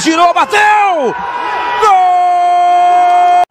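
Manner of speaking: slow, rising shout into the goal; goal call. Real, not acted. Narrator calls the goal.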